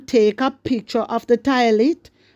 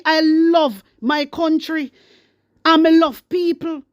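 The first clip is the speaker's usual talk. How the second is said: ordinary delivery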